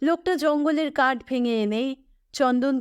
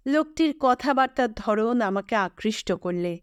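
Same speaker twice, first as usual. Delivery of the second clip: ordinary delivery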